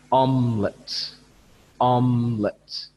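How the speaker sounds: omelette slow and loud, OM punched; stress on first syllable. Shown as a demonstration. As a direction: lean on OM in omelette, slowly. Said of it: "the sound is big".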